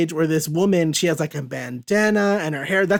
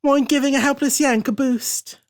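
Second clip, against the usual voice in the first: higher-pitched put-on English accent; 8 semitones higher, 3 dB louder than usual. English woman hailing a sailor for a boost, hammed-up English accent. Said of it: "I get to bust out an accent".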